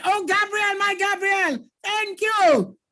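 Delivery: high, bright burst of joy at a name; delighted greeting. Real, not acted. Teacher thrilled to see Gabriel in the chat, excited high call. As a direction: call out to him like a long-lost friend.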